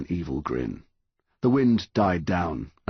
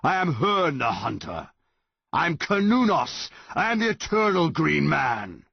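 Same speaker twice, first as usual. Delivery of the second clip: monster proclaiming; 10 semitones higher than usual. A narrator's reading.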